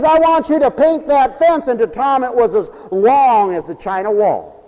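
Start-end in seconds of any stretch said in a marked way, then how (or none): 0.0-1.7 higher, louder mock scolding order; lightly voiced stern aunt. Aunt handing Tom his Saturday punishment, mock-stern and loud. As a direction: give a hammed-up guardian's command.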